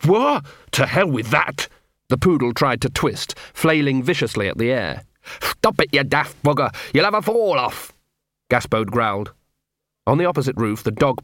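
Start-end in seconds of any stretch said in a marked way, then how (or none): 0.1-1.7 sharp high cry, then defiant; indignant outburst. Acted. Big Fido refuses rescue by the collar, outraged higher yelp. 5.4-7.9 higher, strained yelling; exasperated shout. Acted. Gaspode yells at the thrashing poodle, exasperated and higher.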